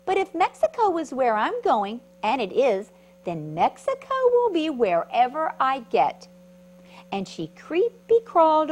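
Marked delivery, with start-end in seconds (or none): 0.1-6.3 bright excited caterpillar. Acted. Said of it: "said the creepy crawly bug".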